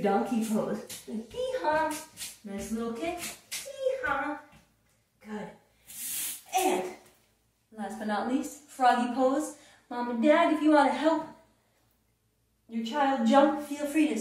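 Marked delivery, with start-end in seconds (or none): none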